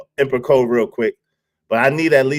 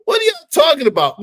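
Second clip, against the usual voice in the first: fast mock exasperation; 12 semitones higher, faster than usual. Real, not acted. Exasperated at chat.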